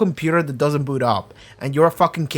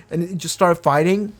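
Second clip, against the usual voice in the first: ordinary delivery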